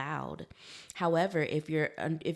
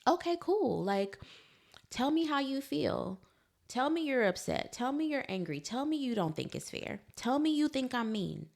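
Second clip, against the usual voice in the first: inviting feelings; 9 semitones higher than usual. Retold or imitated. Parent inviting child's feelings.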